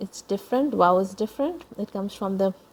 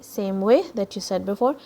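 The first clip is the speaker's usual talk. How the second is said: normal talking voice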